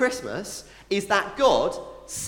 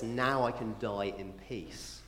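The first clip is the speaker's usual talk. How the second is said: put-on hushed old man; 6 semitones lower, 11 dB quieter than usual. Old Simeon quoted, hushed.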